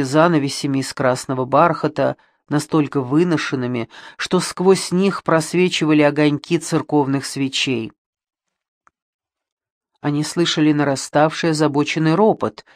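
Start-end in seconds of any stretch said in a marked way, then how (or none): none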